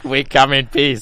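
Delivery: slow, loud alien greeting. Pretend. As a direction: announce yourself like a visiting alien. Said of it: "We sound like aliens".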